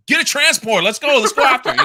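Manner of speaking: high, loud mock command; Padme. Fan acting Padme snapping back into action, high and loud. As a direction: imitate a sudden snap into urgent orders. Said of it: "She snaps back into Padme".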